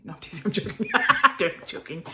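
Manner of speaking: loud laugh through the words; laughingly taking it back. Real, not acted. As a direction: crack up at your own joke. Laughs at own joke.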